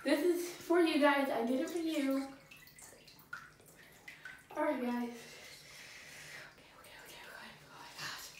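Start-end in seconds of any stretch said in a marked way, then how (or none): 4.9-8.3 breathless whisper. Real, not acted. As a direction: whisper to brace yourself. Bracing in ice bath.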